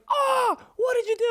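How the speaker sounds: high, alarmed teacher voice. Retold or imitated. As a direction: ask in shock like the startled teacher. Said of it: "the teacher came out and was like".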